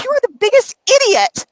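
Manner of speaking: high-pitched, indignant retold rant; screaming at brother. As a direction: berate your brother in outrage. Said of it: "when I was screaming at my brother".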